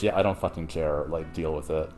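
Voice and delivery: low, quiet shrug-it-off retelling; lightly voiced, brushing it off low and soft; dismissive Gilgamesh. Podcaster retells Gilgamesh brushing off the gods, dismissive. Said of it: "But Gilgamesh is like".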